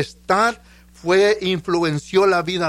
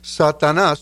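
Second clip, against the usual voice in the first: ordinary talk, nothing marked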